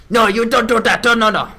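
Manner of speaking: a hint of an angry Frenchman; mock angry French. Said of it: "French sounds so angry".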